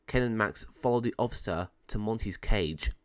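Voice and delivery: plain reading voice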